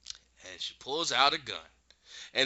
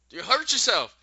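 urgent warning; 12 semitones higher, 9 dB louder than usual. Retold or imitated. Dorothy warning about gun.

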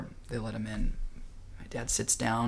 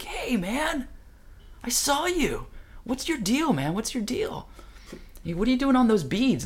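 excited hailing; 14 semitones higher than usual. Retold or imitated. Dad accosting the chanting hippie, high-pitched hailing. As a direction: call out to a stranger in a high voice.

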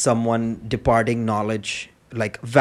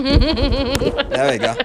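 laughing; 11 semitones higher, much slower than usual. Pretend. Pretend taking pants off.

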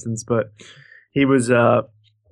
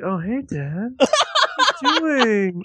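put-on joking baby voice; 10 semitones higher, slower than usual. Teasing a friend about his dad, mock baby voice. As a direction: imitate a friend's baby talk, rising high. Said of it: "I'd use that voice".

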